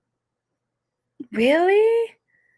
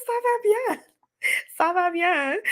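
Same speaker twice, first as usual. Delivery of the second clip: squeaky reply; 8 semitones higher than usual. Retold or imitated. Her reply to the teacher.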